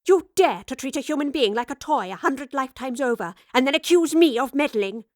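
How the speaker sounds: the professor rages at Raleigh; raged. Acted. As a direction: build the fury to the end. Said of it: "the professor raged".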